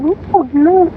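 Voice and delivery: very high mock protest; squeaked little denial; imitated girlfriend. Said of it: "You're like".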